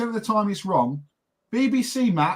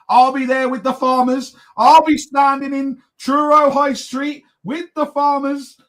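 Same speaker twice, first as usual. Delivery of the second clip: mock-defiant mum, higher and louder; higher, louder, slowed imitation of a stubborn mum; imitating mum; 5 semitones higher, 5 dB louder than usual. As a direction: play the stubborn mum vowing to stand with the farmers. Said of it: "she's like".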